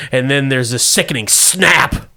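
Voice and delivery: ordinary talk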